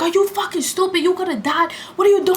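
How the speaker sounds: high, alarmed scolding; cousins yelling. Retold or imitated. Cousins scolding her on the tracks, high and alarmed. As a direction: scold her for nearly dying.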